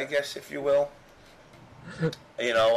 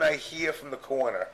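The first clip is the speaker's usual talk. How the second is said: ordinary talk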